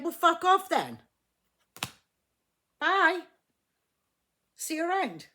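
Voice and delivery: high, loud acted send-off; dismissive kiss-off. Acting the Empress's dismissive reply.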